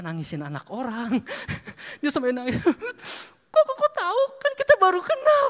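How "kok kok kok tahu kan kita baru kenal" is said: much higher, louder, a stunned tearful woman; startled crying woman. Retold or imitated. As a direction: squeak it out like a woman caught off guard in tears. Tearful woman asks how he knew. (suara perempuan kaget sambil menangis, jauh lebih tinggi)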